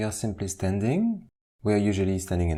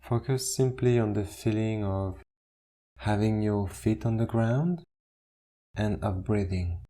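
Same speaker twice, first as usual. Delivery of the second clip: guided meditation. Acted. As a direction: ease them into stillness, one instruction at a time. Leads a standing meditation.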